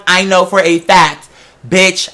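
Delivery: ordinary talk, nothing marked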